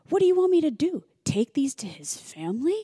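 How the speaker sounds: high loud mock outrage; exasperated outburst. Chris refusing to deliver the bracelets, high and loud. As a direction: throw the question back, exasperated. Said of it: "and he goes".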